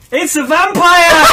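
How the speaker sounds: high, loud climactic shout; shouted reveal. A narrator's reading. Reading out the story's silly final twist, shouted reveal. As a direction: belt out the big twist.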